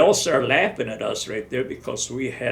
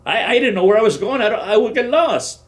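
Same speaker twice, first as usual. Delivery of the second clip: loud, higher, hurried self-defence; protesting loudly; 9 semitones higher, 7 dB louder than usual. Retold or imitated. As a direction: plead your case over him. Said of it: "I says".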